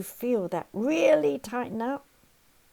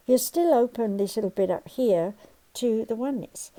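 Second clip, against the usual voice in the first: usual speaking voice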